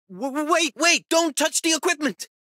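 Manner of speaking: very high, stammering alarm; flustered panic. Acted. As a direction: stammer in panic to stop them, pitch shooting up. Character stops someone touching the equipment.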